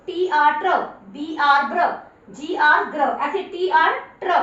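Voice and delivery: ordinary talk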